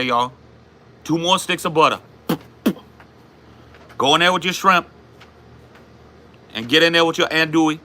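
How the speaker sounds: high, hollered Cajun cook; Cajun accent. Acted. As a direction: play a Cajun cook calling out ingredients. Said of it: "How's your Cajun accent so good?".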